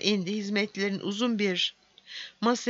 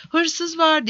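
raised, higher-pitched alarm call; crying thief; 8 semitones higher, 4 dB louder than usual. A narrator's reading. Dwarf cries thief while running.

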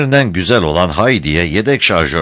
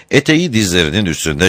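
plain reading voice

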